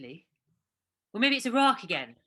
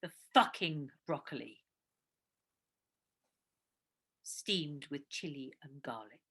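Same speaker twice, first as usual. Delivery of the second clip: sulky mutter; 9 semitones lower, 5 dB quieter, slower than usual. Real, not acted. Sulking after the fight.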